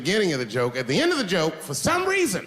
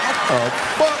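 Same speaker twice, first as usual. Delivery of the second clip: high, loud outraged what the fuck; shocked yell; 11 semitones higher, 5 dB louder than usual. Retold or imitated. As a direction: shout in disbelief. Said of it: "I said".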